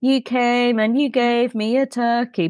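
high, drawn-out cartoon quote; Simpsons character quote. Quoting a Simpsons episode.

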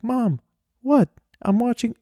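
put-on high, squeaky innocent kid voice; fake innocent. Caught by mom, feigning innocence.

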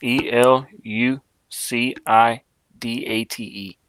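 letter by letter, slowly; spelling it out. Shown as a demonstration. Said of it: "Can you spell elucidate?".

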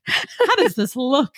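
slow, drawn-out mock question; mock fussy voice. Shown as a demonstration. Friend posing the question, slow and affected. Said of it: "repeated in put-on voice".

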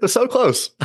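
teasing, high-pitched laughing reply; amused ribbing with a real laugh. Real, not acted. Laughs at failed accent attempt.

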